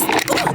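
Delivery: high breathy gasped ooh; a sharp high ooh of dread; shocked ooh. Retold or imitated. Telling viewers about the test, a shocked ooh.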